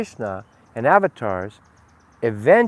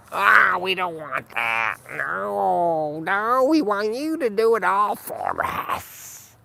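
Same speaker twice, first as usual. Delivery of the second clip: whiny crowd refusal; 6 semitones higher, much slower than usual. Retold or imitated.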